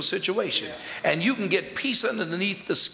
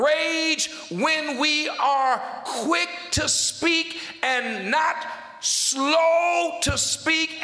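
high, slow-hammered preacher's cadence; raised, insistent pulpit delivery; forceful preaching; 10 semitones higher, slower than usual. Real, not acted. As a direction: preach it out with drawn-out key words.

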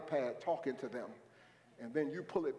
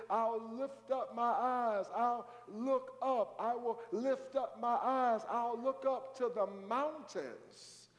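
slow, raised-pitch psalm reading; high, stretched preacher's recitation; scripture declamation; 7 semitones higher, slower than usual. Acted. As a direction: draw out each phrase for the congregation.

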